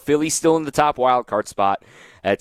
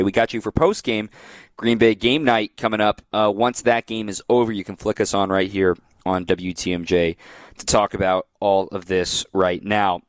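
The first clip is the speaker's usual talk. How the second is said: ordinary talk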